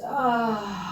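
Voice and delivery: long airy "ah" on the out-breath; audible sighed exhale. Shown as a demonstration. Teacher showing a landing exhale, long sighed "ah". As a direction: breathe out as if steaming up a window. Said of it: "steaming up a window with an A H sound".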